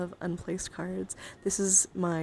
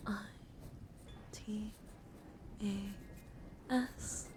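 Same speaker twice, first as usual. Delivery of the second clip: ASMR name spelling; 7 dB quieter than usual. Acted. Streamer spelling a new follower's name, hushed ASMR voice. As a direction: spell it softly with long pauses.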